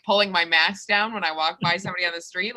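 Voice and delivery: usual speaking voice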